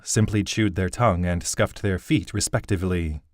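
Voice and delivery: usual speaking voice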